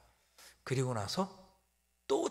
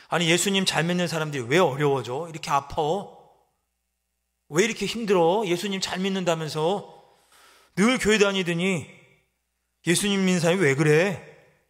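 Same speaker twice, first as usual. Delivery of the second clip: louder, scoffing questions; mocking critic; 4 semitones higher, 8 dB louder than usual. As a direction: needle the believer. Critics mocking believers today.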